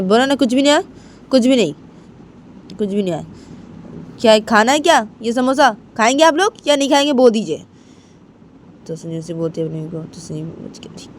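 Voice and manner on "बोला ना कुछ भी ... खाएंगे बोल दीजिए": higher, louder snapping; fed up, demanding an answer; angry son. Acted. (गुस्से में बेटा, ऊँची तेज़ आवाज़)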